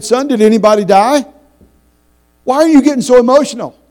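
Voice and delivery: high, loud scolding father; retold berating. Father scolding his son at the restaurant. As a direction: snap at the boy, exasperated. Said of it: "I berated him".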